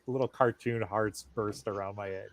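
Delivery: usual speaking voice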